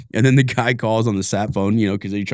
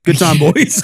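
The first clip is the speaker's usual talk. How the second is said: mock contented toast; 5 semitones higher, 6 dB louder, slower than usual. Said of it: "you're like".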